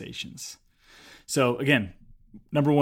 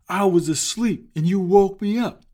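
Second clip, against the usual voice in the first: higher, louder, gruff complaint at being woken; grumpy complaint; 7 semitones higher, 6 dB louder than usual. Retold or imitated. Woken homeowner scolds the salesman at the door, indignant. Angry man woken by knocking.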